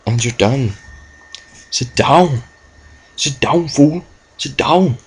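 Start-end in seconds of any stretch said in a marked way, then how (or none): 1.7-5.0 higher, swaggering sit down fool; cocky taunt. Acted. Gamer trash-talking the fainted Pokemon with cocky swagger. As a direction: lord it over the loser.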